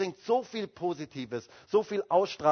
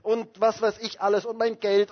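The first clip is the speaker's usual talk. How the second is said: mock complaining in a high, raised voice; imitated moaning; 4 semitones higher, 6 dB louder than usual. Preacher mimicking negative Christians who forget God, moaning. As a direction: reel off grievances in an agitated run-on. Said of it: "sie jammern und jammern und jammern".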